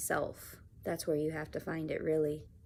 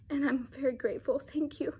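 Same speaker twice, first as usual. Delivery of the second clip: tearful thanks; 7 semitones higher than usual. Real, not acted.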